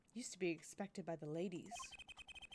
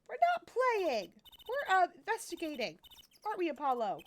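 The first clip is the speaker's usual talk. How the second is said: put-on indignant girl; 8 semitones higher, 8 dB louder than usual. Girl protesting to the cop, high and loud. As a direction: squeal it, offended at the cop. Player voices Athena protesting.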